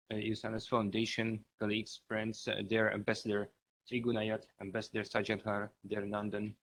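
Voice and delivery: soft, distant speech, hard to hear; too quiet. Real, not acted. Guest thanking the organizers at a conference, too quietly. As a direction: speak too softly, away from the mic. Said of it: "could you speak a little louder".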